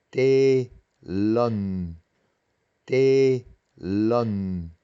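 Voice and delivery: "telen" with a drawn-out double-length E; long double vowel. Shown as a demonstration. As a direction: hold the first E in "telen", then a separate second syllable. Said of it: "pero se pronuncia doble".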